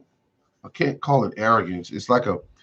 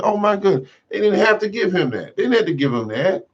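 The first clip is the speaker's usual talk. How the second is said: high, exasperated disbelief; higher, appalled exclamation; dismayed outburst; 10 semitones higher than usual. Retold or imitated. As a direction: protest that they didn't have to pay him. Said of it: "I said".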